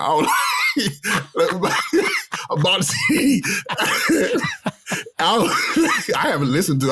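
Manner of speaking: talking through real high laughter; cracking up. Real, not acted. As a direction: laugh helplessly at your own purchase. Cracking up at own purchase.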